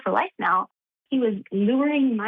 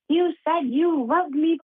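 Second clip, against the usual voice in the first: retold screaming; 8 semitones higher, slower than usual. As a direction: imitate a betrayed lover's yell, word by word. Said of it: "starts screaming".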